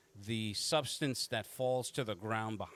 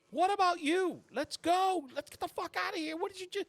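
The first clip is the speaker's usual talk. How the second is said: very high-pitched panicked yell; frantic Abby; faster than usual. Acted. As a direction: shriek it in a frantic falsetto. GM voices panicked Abby shooting.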